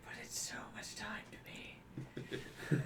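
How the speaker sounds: slow, voiceless murmur; plaintive whisper. Pretend. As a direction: whisper it slowly, as a small protest. Mock-plaintive answer to the immortal.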